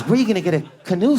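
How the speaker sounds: high, rushed mock girlfriend; questioning girlfriend. Girlfriend questions Serge about the canoe, high and hurried. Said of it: "She goes".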